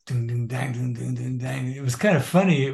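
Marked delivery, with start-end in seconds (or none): 0.1-1.7 imitated beat. Shown as a demonstration. Drummer demonstrating the first version's beat, vocalized groove. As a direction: chant the beat on ding and dang. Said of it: "the beat was like".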